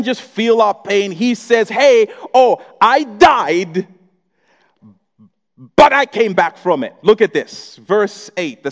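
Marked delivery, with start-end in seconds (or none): 1.7-6.9 triumphant Jesus voice. Acted.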